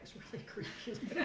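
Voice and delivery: a breathy laugh breaking into a loud burst. Real, not acted. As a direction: laugh at how creepy it was. Laughing at creepy gift.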